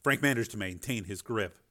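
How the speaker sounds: usual speaking voice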